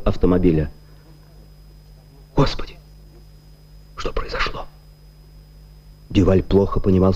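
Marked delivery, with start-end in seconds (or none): 2.3-4.7 shocked breathless whisper. A narrator's reading. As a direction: whisper it in disbelief. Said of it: "shocked whisper after shooting".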